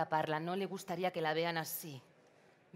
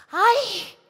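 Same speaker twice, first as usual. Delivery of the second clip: sharp high pained scream; 15 dB louder than usual. Acted. María bursts into the kitchen, screaming loudly in pain. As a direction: cry out from the sting. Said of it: "entra gritando".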